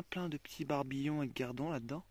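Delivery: normal talking voice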